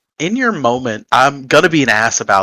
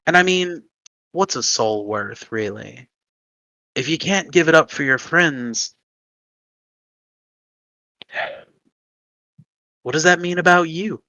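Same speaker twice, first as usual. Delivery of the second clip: softer, insinuating voice in her head; tempting inner voice; 2 semitones higher, 6 dB quieter than usual. Acted. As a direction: needle her about her soul. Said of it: "you hear a voice in the back of your head".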